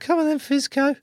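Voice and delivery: sing-song mock taunt from rival teams. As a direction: jeer at him like an opposing player. Opponents mocking him.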